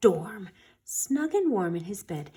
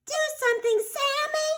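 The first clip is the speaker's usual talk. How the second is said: much higher, frantic mom voice; mom's cry. A narrator's reading. Sammy's mom to Sammy, crying out high. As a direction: cry out to your son for help. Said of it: "cried Sammy's mom".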